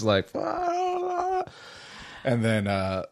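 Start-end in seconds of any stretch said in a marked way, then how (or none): 0.3-1.4 very high-pitched mock scream; top-of-lungs scream, imitated. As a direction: imitate screaming your lungs out without real volume. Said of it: "screaming at the top of my lungs".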